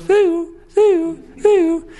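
very high, bright chirping bird cry; high-pitched bird imitation; little bird call. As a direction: squeak it out like a tiny warning bird. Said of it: "the little bird goes".

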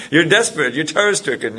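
a laugh breaking through the words. Real, not acted. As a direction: say it half laughing, amused by the image. Laughing while describing panic.

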